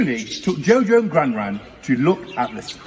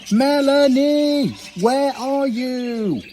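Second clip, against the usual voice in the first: high, slow searching call; 9 semitones higher, much slower than usual. A narrator's reading. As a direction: stretch it out, looking everywhere. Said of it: "But all Jojo could".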